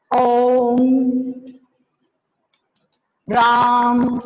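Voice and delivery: ओम and राम held three times as long, chanted; teacher stretching ओम and राम like a temple chant; drawn-out pluta vowels. Shown as a demonstration. Hindi teacher demonstrating long pluta vowels to students. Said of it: "इसमें हमें बोलने में तिगुना समय लग रहा है".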